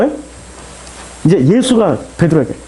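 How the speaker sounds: ordinary talk, nothing marked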